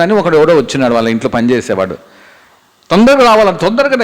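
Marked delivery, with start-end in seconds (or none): 2.9-4.0 high, hurried urging; urgent summons. Acted. Servant urges David to come quickly, high and pressing. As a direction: hurry him along.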